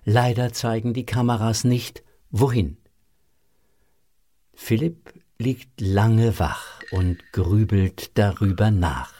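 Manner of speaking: ordinary talk, nothing marked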